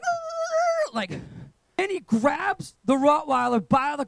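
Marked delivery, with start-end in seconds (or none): 0.0-0.9 imitated roar. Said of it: "He's like".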